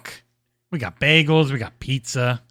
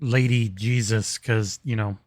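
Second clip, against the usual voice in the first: lower mock lecturing; 9 semitones lower, 4 dB quieter than usual. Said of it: "gonna be like".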